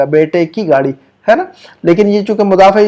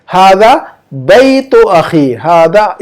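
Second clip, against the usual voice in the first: हादा बैतु अखी spoken slowly, word by word; slow model reading; much slower than usual. Shown as a demonstration. Teacher presenting the Arabic answer, slowly. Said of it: "इसकी अरबी बनेगी ऐसे".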